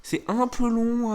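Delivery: "un peu long" stretched out, high-pitched; drawn-out emphasis. Real, not acted. Player explaining a cut video to viewers, stressing "un peu long" high and slow. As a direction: drag "un peu long" slowly upward. Plaint de la longueur de vidéo.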